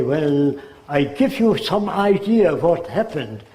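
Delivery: mock German-English accent; lightly put-on German accent in English. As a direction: speak like a German immigrant, German accent. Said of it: "That's the way they speak".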